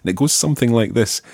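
usual speaking voice

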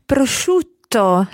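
"prosciutto" with the double T held, then released; bounced double T. Shown as a demonstration. Teacher corrects the learner, bouncing on the double T in "prosciutto". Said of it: "Remember to bounce on that T".

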